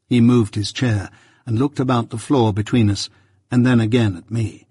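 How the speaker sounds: usual speaking voice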